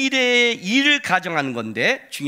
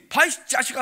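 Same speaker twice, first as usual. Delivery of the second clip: defiant taunt; 6 semitones higher, 4 dB louder than usual. Retold or imitated. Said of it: "당당하고".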